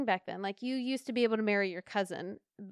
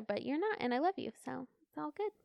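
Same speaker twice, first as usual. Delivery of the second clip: tender aside; 4 semitones higher, 8 dB quieter than usual. Real, not acted.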